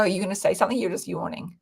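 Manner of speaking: ordinary talk, nothing marked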